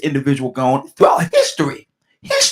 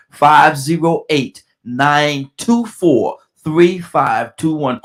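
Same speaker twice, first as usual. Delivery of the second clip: slow, louder, carefully spaced digits; real slow; 4 dB louder, much slower than usual. Real, not acted. Host making sure callers get the number, slow and emphatic. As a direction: spell out the number slowly so nobody misses it. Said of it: "make sure that you get the number".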